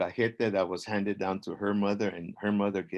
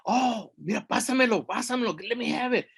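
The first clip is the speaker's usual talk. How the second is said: high, excited little pleading; eager ant; 12 semitones higher, faster than usual. Acted. Ant asking to be passed the concha, eager. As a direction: reach for it with your voice, keen.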